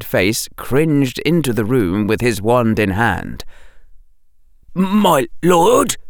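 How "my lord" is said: timid, high, hesitant question; a cringing servant asking; fearful servant voice; faltering. A narrator's reading. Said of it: "the servant said falteringly".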